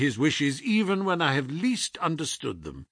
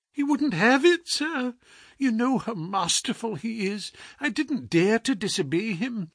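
a lightly raised, anxious landlady voice; worried housekeeper; 6 semitones higher than usual. A narrator's reading. Housekeeper telling the doctor the detective is dying, lightly anxious. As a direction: plead the news like a frightened housekeeper. Mrs Hudson voiced in story.

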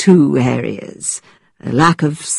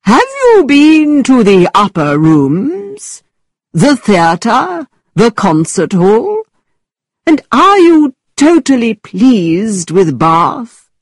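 slow, stagy exclamation; mock-theatrical gentleman; actor's voice; 6 semitones higher, much slower than usual. A narrator's reading. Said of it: "continuing with his actor's voice".

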